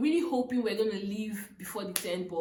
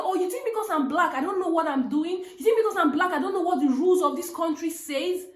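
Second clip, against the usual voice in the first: louder, higher retold indignation, rising on each question; 7 semitones higher, 6 dB louder than usual. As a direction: confront him about race. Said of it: "I read my landlord the riot act".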